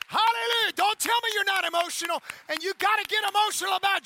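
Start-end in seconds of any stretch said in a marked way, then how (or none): 0.1-2.2 loud, rising preacher's call to praise, shouting to the congregation; full-force preaching. Acted. Pastor to Life Church, shouting them into praise. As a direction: stir the crowd up like a revival preacher.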